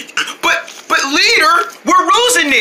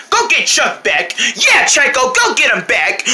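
ordinary talk

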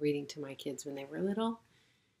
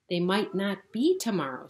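1 doubtful protest; 3 semitones higher, 8 dB louder than usual. Acted. Rabbit doubts.

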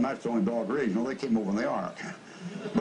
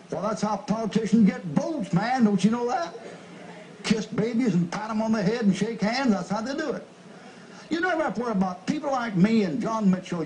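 higher, pushed preacher delivery; preaching at full force; 8 semitones higher, 3 dB louder than usual. Acted. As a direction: rail at the congregation. Preacher scolding congregation.

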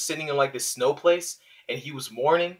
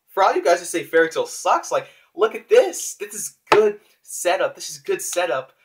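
excited insistence; 5 semitones higher, 6 dB louder than usual. Real, not acted. Defending the manga to critics.

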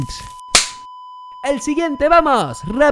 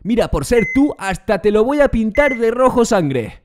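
ordinary delivery

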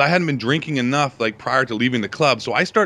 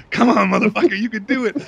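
pep talk; 9 semitones higher, 4 dB louder than usual. Retold or imitated.

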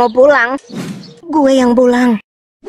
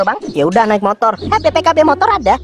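ordinary talk

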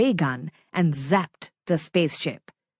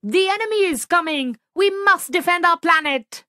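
high, fast panicked warning; urgent alarm; 9 semitones higher, faster than usual. Acted. Zap and Whip warning Chump, high hurried alarm. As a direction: sound the alarm at top pitch.

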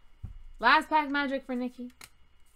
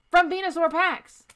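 raised, higher voice to drive it home; emphatic insistence; 6 semitones higher, 9 dB louder than usual. Real, not acted. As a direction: loudly hammer the point. Emphatic rant about pack art.

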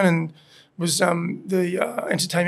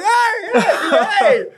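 laughing stage greeting; 14 semitones higher, 6 dB louder, much slower than usual. Retold or imitated. Grabbing live mic on stage.

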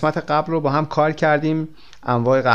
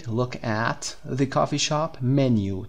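very slow model reading; much slower than usual. Shown as a demonstration. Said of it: "تلفظ menu".

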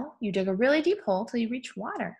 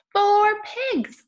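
high, loud exclamation; excited count finish; 13 semitones higher, 6 dB louder than usual. Pretend. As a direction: land the final number with playful delight. Triumphant end of counting for children.

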